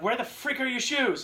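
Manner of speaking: high, loud angry yell from dad. Retold or imitated. Father yelling at son in car about missing shoes, loud and high. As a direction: bellow it like a furious father. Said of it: "my dad's like".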